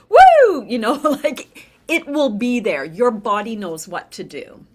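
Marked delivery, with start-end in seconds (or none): 0.1-0.7 sudden, much higher, louder whoop; startled yelp. Pretend. Surprised by someone jumping out, a loud high startled woo. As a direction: yelp as if someone just jumped out at you.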